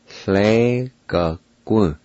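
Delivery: Hle ga gu drawn out slowly, every syllable clear; slow careful pronunciation. Shown as a demonstration. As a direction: stretch Hle ga gu for the learner. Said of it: "pronounce these words".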